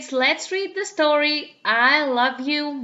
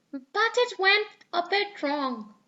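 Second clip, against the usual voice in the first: put-on little girl voice; 7 semitones higher than usual. Peppa's voice.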